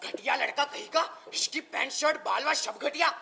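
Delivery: loud, scornful scolding; angry insults. Acted. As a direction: hurl each insult loudly. Father insults the young man. (गुस्से में फटकारते हुए)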